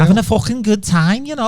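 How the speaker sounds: put-on over-the-top Scouse girl boasting; Scouse accent. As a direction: ham up a Scouser from Liverpool. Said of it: "I think I'm all right at Scouse".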